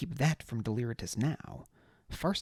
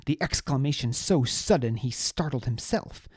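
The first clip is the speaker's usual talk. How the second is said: ordinary delivery, nothing marked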